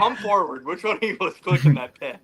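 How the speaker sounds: high mock-stern demand. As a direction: play a mock interrogator. Mock interrogation of pen clicker.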